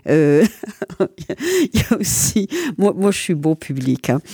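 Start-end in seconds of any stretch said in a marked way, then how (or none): none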